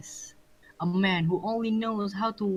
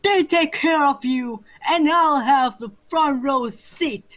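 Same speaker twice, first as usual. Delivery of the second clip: louder, higher taunting shout; 6 semitones higher, 6 dB louder than usual. Acted. Villain gloats at the hero, a loud taunting shout. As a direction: gloat loudly from above. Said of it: "She shouted!".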